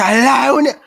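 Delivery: high, loud imitation of a defiant mother; mocking his mother's fierce cry; mother's shout. Mother voiced defiantly in story.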